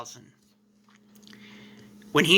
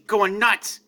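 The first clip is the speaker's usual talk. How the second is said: ordinary delivery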